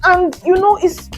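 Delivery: breathless and winded; gasping between words; out of breath. Real, not acted. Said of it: "girl, I'm out of breath".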